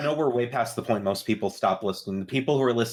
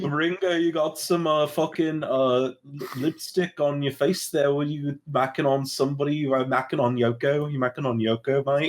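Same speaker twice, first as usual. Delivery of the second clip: put-on Liverpool accent. Host teasing a Beatle about lipstick, Liverpool accent. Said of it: "do your best Liverpool impression".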